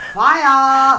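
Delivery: excited shout. Real, not acted. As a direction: FIRE called out high and loud. Excitedly calling out the answer.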